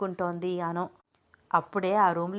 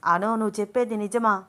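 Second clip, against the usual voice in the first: a startled, louder question; surprised; 3 semitones higher, 4 dB louder than usual. A narrator's reading. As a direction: ask in disbelief. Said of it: "ఆశ్చర్యంగా అడిగాడు".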